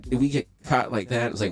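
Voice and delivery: ordinary delivery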